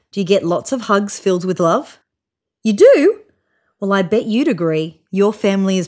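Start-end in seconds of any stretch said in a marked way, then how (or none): 2.6-3.2 high, louder surprised question; light up as if amazed; delighted surprise. A narrator's reading. Reader answers listener's yes.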